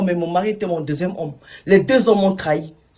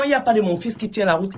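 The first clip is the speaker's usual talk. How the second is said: ordinary delivery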